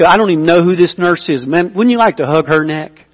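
ordinary talk, nothing marked

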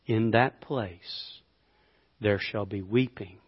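slow, low, weighty verse reading; solemn scripture reading. Acted. Preacher reads the parable's verse to congregation, slow and grave. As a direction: let each phrase sink in, softening toward the end.

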